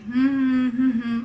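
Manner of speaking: short hummed murmur with closed lips; humming demo. Shown as a demonstration. Teacher showing students what humming means, humming. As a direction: hum a little wordless snatch. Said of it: "like this".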